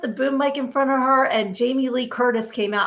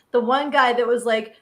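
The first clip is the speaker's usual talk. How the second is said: ordinary delivery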